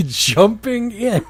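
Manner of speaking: slow, laughing "Jumping in"; real laughter over "Jumping in"; laughing repeat. Real, not acted. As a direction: chuckle the words out in disbelief. Laughing at the fanfic phrase.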